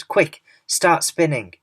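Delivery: urgent loud, high orders; yelling. A narrator's reading. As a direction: hurry her on with a shout. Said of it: "yelled James".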